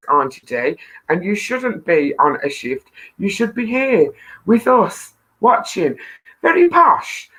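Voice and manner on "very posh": high, loud mock posh voice; reading the comment with a hammed-up posh lilt. Host reading a viewer's comment aloud, mock posh. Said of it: "I don't think I've been called posh before".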